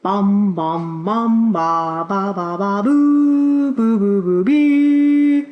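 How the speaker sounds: tuba imitation. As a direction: sing out the tuba's notes like a bumbling brass player. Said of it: "I take out my tuba and begin to play".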